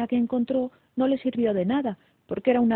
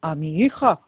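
louder, eager answer from a king; a touch of hopeful eagerness; hopeful exclamation; 3 semitones lower, 6 dB louder than usual. A narrator's reading. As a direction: exclaim it with hope. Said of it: "exclamó el rey esperanzado".

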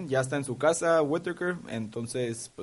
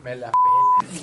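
squeaky joke voice; 7 dB louder, slower than usual. Acted. Joking banter before recording.

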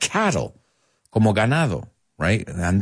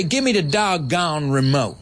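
mock Southern; 6 semitones higher than usual. A teacher mimics a Southerner demanding the remote, hammed-up. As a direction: play a wild west Southerner. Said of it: "But in the South, say".

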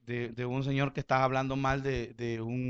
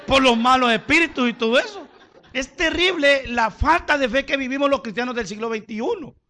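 preaching at full force; 9 semitones higher, 10 dB louder than usual. Acted. Preacher reading John 3:36 to the church, loud preaching. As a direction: thunder the verse at them.

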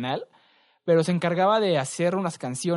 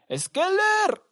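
high, loud imitated catchphrase; big Skeller yell; 5 dB louder than usual. Retelling the don's great Skeller, high mock shout. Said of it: "da su, su gran".

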